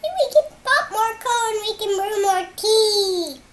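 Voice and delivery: very high, loud squeaky mouse voice. Retold or imitated. Mouse comforting the sleepless bear, squeaky high voice. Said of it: "the mouse says".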